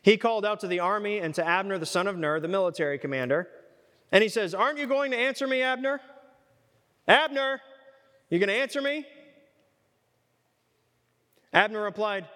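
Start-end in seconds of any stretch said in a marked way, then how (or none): 4.5-9.1 calling out across the valley. Acted. David calls across to Abner.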